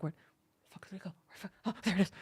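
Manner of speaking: hushed, breathless whisper as the cat searching. Retold or imitated. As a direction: whisper frantically like a hunting cat. Cat voiced hunting for tassel.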